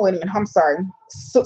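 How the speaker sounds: ordinary talk, nothing marked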